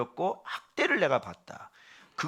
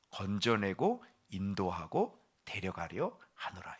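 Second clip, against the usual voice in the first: soft summary; 5 semitones lower, 9 dB quieter, slower than usual. Shown as a demonstration. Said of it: "이걸 정리해 보니까".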